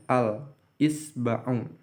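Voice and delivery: "Al-isba'u" said slowly with a distinct l; careful slow "Al-isba'u", l clearly heard; slow clear demo. Shown as a demonstration. Teacher repeating the example slowly for students. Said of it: "ini dibaca jelas la-nya".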